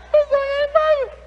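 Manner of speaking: high, loud squeal of disbelief; squealing amazement. Retold or imitated. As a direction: shriek it like a wide-eyed tourist. Excited caller on phone.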